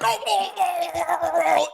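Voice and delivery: very high, comic ecstatic moaning; exaggerated run of high groans; mock climax moans. Imitating husband being distracted, in mock moans. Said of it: "he's like".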